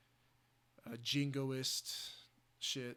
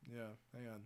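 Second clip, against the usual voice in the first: ordinary talk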